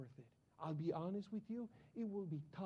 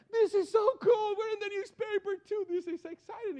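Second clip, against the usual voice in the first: put-on thrilled teen; 13 semitones higher, 12 dB louder than usual. To his sister over the newspaper, a high excited shout. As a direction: brag like a giddy kid in the paper. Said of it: "I look at my sister".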